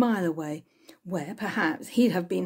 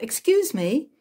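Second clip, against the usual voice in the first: higher, louder call after someone; 7 semitones higher, 5 dB louder than usual. A narrator's reading. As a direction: call out to the stranger walking away. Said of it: "calling out".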